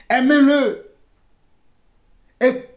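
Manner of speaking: normal talking voice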